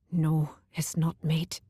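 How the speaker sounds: choked whisper through tears. A narrator's reading. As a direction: force it out past a tight throat. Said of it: "he choked".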